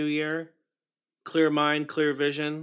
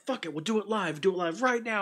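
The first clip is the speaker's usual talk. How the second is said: imitating a ranting newscaster, pitched up; mock the anchor's meltdown, higher; newscaster flipping out; 8 semitones higher than usual. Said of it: "he's just like".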